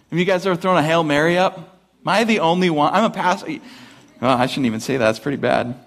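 ordinary delivery

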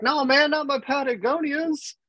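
very high, squeaky mock protest; mock whiny buddy. Teammate refusing to cut his shirt, in a high mock whine.

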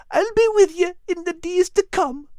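very high, small Scottish dog voice; a tiny Scottish terrier speaking gently; whimpering little dog. A narrator's reading.

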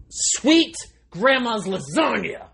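high, loud, drawn-out exclamation; slow comic yell; mock-curse outburst. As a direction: belt it out in place of swearing.

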